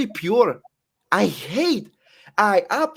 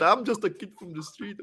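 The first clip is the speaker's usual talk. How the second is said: corny; 3 semitones higher, 3 dB quieter than usual. Retold or imitated. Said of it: "that sounds so corny".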